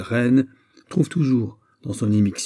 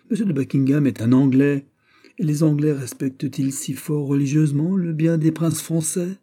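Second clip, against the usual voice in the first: higher, indignant retort; exclaimed; 6 semitones higher, 5 dB louder than usual. Acted. Philippe challenges his mother on English loyalty, exclaimed. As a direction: argue back with a raised voice. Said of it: "s'écria Philippe".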